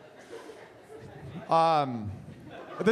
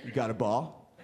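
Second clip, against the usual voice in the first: low dude dog voice; 5 semitones lower than usual. Pretend. Comedian voicing his dog asking for the ball, a low laid-back bro.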